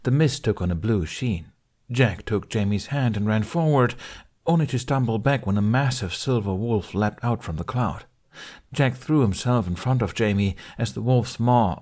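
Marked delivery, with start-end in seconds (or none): none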